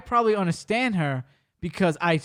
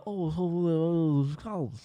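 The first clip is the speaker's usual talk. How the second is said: Dre; 4 dB quieter, much slower than usual. Retold or imitated. Housemate answering from across the house, mumbling. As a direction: mumble back unintelligibly, fading away. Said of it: "then Dre will be like".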